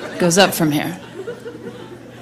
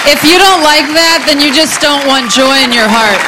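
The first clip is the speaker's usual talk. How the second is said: loud, raised declaration; calling over applause; 5 semitones higher, 8 dB louder than usual. Real, not acted. Comic addressing applauding audience after clown horn, calling out. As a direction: project it high over a cheering crowd.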